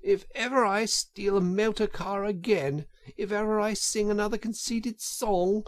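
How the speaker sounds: high, desperate vow; gasping remorse. A narrator's reading. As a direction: swear it frantically while struggling for air. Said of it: "gasped the poor Toad".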